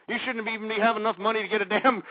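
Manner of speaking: usual speaking voice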